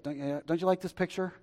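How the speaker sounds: usual speaking voice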